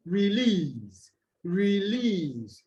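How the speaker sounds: reLEASE, slow with a long "lease"; light "re", stretched "lease"; release, rising into a long second syllable then falling; second-syllable stress. Shown as a demonstration. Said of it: "stress on this is on the second".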